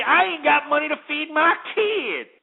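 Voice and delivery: high, whining mock complaint; whiny mimicry. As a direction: mimic a broke parent whining.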